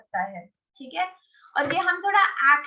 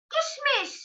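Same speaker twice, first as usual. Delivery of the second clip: "किशमिश" squeaked up high; a tiny mouse-like "किशमिश"; high squeaky voice; 12 semitones higher than usual. Shown as a demonstration. Teacher demos the raisin word like a scared mouse, high. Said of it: "अगर मैं बोलूंगी".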